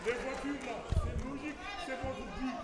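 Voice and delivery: high, laughing through the words; amused laughing. Real, not acted. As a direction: joke while laughing. Laughing jokey aside to President.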